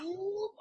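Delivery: high comic "whoop"; quick high whoop, as if popping up somewhere new. Retold or imitated. Acting out popping up on screen.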